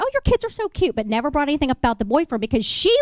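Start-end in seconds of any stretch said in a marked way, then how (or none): 0.0-1.0 much higher cooing voice; gushing over kids. Retold or imitated. Attorney cooing over kids in her waiting room. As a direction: coo over the cute kids. Playing innocent to the wife.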